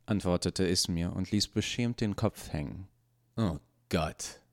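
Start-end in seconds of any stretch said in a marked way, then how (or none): 3.4-4.4 sighing. A narrator's reading. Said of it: "seufzte Nachtigall".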